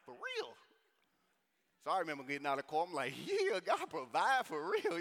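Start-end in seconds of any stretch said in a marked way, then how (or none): 0.0-0.5 high-pitched disbelieving question; acted astonishment, voice leaping way up; incredulous. Amazed at cousin's offer of money. 3.3-5.0 loud, high, drawn-out celebration; acted jubilation, slow and raised; elated. Retelling joy at the money offer.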